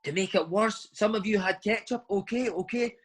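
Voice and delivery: high, slow mock tirade rising with each line; angry manager impression. Manager ranting at players about ketchup and mayonnaise, high mock rant. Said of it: "And he says".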